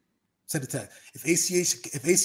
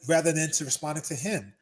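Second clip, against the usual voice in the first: normal talking voice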